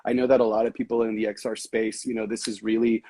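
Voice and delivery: ordinary delivery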